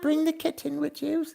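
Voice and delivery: put-on high old British lady voice, tucking children in; sing-song granny British bedtime voice; old lady British accent. Old British lady saying goodnight to viewers, in a high old lady British accent. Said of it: "say goodnight in an old lady British accent".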